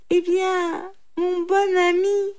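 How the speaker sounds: high, loud animal voice; triumphant jackal. A narrator's reading. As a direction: address him slyly, pitched high. Jackal triumphantly addresses brahman. (chacal triomphant, voix aiguë)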